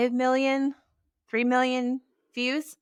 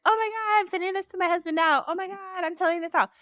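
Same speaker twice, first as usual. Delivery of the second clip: high, fast, gushing excitement; gushing fans; 11 semitones higher, faster than usual. Retold or imitated. Imitating fans sharing her reel, high fast gushing. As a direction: rattle it off like a thrilled fan. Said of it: "good people being like".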